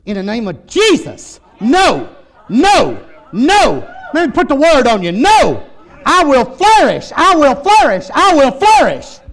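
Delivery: loud, high preacher's declaration; forceful preaching. Acted. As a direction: shout it as a defiant declaration.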